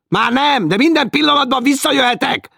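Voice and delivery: angry reply. Acted. Félix answering the nagging woman, angry shout. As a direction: bark it back angrily. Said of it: "felelte dühösen".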